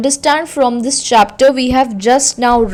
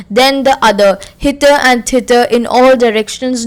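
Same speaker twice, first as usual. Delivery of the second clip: usual speaking voice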